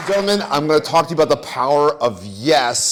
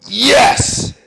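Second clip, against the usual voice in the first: much higher, loud triumphant YES; shouted yes; 11 semitones higher, 9 dB louder than usual. Shown as a demonstration. Speaker demos one word to audience, shouted. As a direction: shout it like you won. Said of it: "I said the same word three times".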